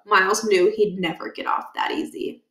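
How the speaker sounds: ordinary delivery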